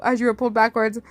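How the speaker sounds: ordinary delivery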